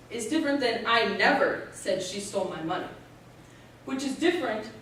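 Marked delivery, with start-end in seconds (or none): 0.9-2.9 "never" given the main emphasis; stress on never. Shown as a demonstration. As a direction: hit the word "never". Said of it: "You can stress each word in that sentence".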